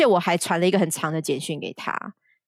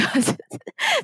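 high giggle. Real, not acted. Recalling playing dumb.

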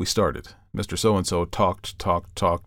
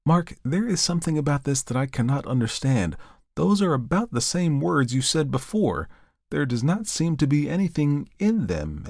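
higher, gently troubled voice; a lightly voiced, moved friend; the reverend asking for the rhyme; concerned reverend; 6 semitones higher than usual. A narrator's reading. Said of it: "Then he said".

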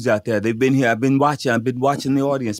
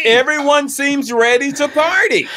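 rowdy party animal; 11 semitones higher, slower than usual. Acted. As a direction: pump up the whole room. Host hyping crowd.

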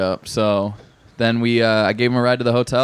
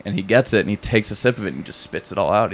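ordinary talk

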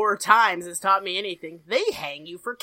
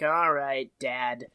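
low, subdued acknowledgement; resigned acceptance; 8 semitones lower, slower than usual. Retold or imitated. Characters grudgingly answering Dad, resigned.

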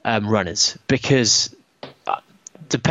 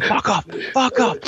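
aggressive mock shout; 10 semitones higher than usual. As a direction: re-enact shouting off a dog, pitched up. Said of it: "I was shouting at it very aggressively".

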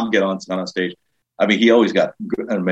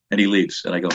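ordinary delivery

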